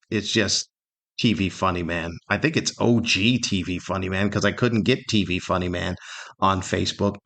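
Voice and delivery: ordinary talk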